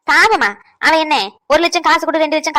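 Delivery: loud, scoffing disbelief; incredulous outburst. Acted. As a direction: throw the word back at her, loud. Grandma scoffs at pity. (உரத்த நம்பமுடியாத கேள்வி)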